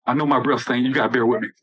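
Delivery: ordinary talk, nothing marked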